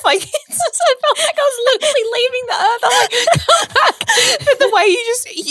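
high, loud, breaking with laughter; can't stop laughing. Real, not acted. Laughing about nearly fainting.

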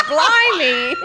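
high, loud British exclamation; hammed-up shocked Brit imitation; mock British boyfriend. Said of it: "He's like".

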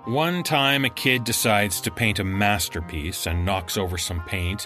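plain reading voice

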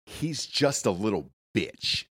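soft, slow, scornful jab; quiet scornful quote. Retold or imitated. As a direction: say it slow and dismissive. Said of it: "she said".

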